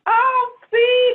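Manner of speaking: high, loud gush of delight; touched delight. Real, not acted. Guest hearing a listener's praise read out, delighted. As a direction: squeal it, touched by the compliment.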